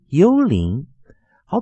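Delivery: ordinary talk, nothing marked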